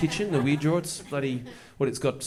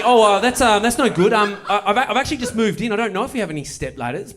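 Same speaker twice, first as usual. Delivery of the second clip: higher and louder, jittery; flustered; 7 semitones higher, 6 dB louder than usual. Retold or imitated. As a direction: babble a nervous excuse. Flustered reply to neighbour.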